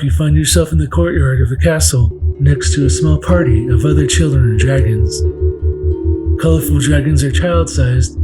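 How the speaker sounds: ordinary delivery, nothing marked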